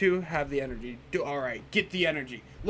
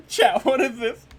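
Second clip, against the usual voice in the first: higher and louder, cracking up; louder baffled plea to chat; louder repeat; 6 semitones higher, 9 dB louder than usual. Real, not acted. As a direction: ask again, baffled and loud.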